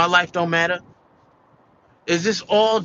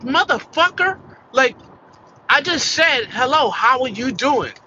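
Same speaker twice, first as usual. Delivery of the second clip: much higher, louder indignant venting; exasperated outburst; 7 semitones higher, 4 dB louder than usual. Retold or imitated. Worker retells inner outrage at his supervisor, exasperated. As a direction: blow up at being ignored. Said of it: "And I'm like".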